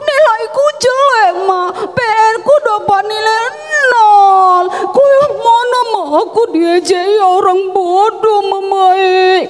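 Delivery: hammed-up wailing child, high-pitched; crying child. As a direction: bawl out your woes to mama, pretend tears. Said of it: "Kita pulang nangis".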